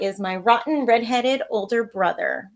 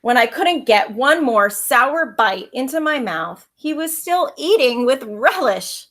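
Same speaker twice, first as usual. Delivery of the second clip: lively read-aloud storytelling voice; story narration; 8 dB louder than usual. A narrator's reading. Teacher reading a picture book to her pupils, lively storytelling.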